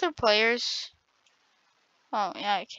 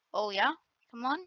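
ordinary talk, nothing marked

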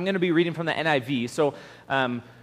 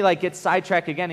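ordinary talk

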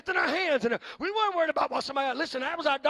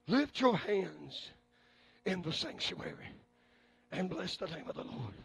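slow solemn; 6 semitones lower, 8 dB quieter, slower than usual. Acted. Slow quoted scripture.

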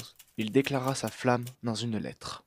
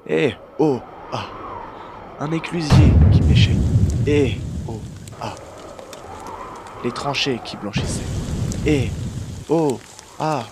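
loud, forceful poem reading; poem recital; 12 dB louder than usual. Acted. Reader reciting a brutal war poem, loud declamation. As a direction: call out each line, then the cries.

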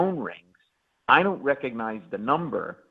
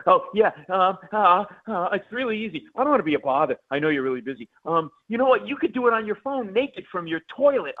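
high-pitched, stammering eagerness; flustered, nervous rambling; 8 semitones higher than usual. Acted. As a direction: babble helplessly while trying to sound easygoing. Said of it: "This is how I sounded".

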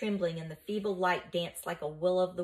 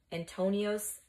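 ordinary delivery

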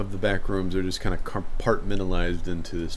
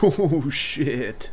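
high startled exclamation; 7 semitones higher than usual. Real, not acted. Game player reacting to a sudden sight, startled. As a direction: blurt it out high, taken aback.

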